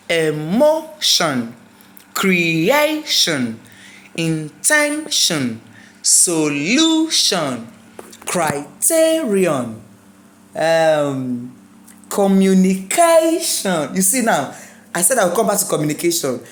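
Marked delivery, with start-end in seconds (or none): 0.1-9.8 slow emotion, creation, intention, solution, criterion with MO, A, TEN, LU, TE stretched; teacher's drawn-out stress in emotion, creation, intention, solution, criterion; stress on penultimate syllable. Shown as a demonstration. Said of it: "The stress must be second to last". 12.1-13.9 teacher's exaggerated CA in communication; stress on CA. Shown as a demonstration. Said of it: "The stress must be second to last".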